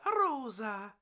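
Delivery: Family Guy character. Acted. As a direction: say Rosa as a cartoon impression. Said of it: "from Fireman Sam to Family Guy".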